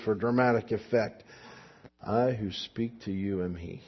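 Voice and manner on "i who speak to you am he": low, soft gravelly voice; gravelly whisper. Retold or imitated. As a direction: lean in and rasp it quietly. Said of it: "whispering in a little bit of a gravelly voice".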